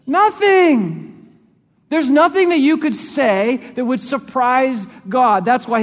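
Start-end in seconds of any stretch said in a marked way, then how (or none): 0.1-4.0 high, loud single word; emphatic shout. Real, not acted. Preacher to church, proclaiming nothing surprises God. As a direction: belt out the answer.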